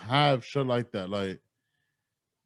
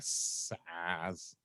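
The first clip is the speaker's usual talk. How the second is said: hissing snake voice, badly done; drawn-out s like a snake; 5 semitones lower, 15 dB quieter than usual. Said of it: "that was horrible Snake impersonation".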